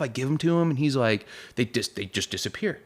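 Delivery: ordinary delivery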